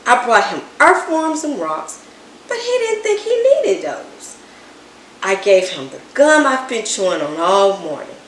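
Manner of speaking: ordinary delivery, nothing marked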